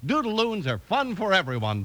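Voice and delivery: ordinary talk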